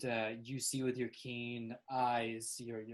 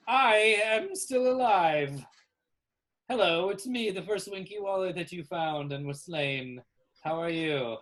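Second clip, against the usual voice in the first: loud, raised, proclaiming creature voice; revived Winky Wally; 6 semitones higher, 10 dB louder than usual. Acted. The slain first Winky Wally returns, loud and triumphant. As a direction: open with a long high announcement, then greet.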